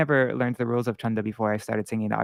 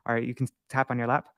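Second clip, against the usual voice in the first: usual speaking voice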